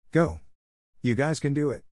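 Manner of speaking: mild cheer from the crowd; crowd shout. A narrator's reading. Students cheering the two fighters on, as a mild shout. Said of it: "everyone shouted".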